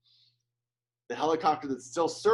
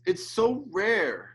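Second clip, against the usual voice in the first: choked up; 8 semitones higher, 5 dB louder, slower than usual. Real, not acted. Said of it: "I'm almost in tears".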